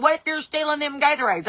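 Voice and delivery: mock suspicious employee; mock accusing clerk. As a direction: accuse her like a frantic guard. Woman chasing her.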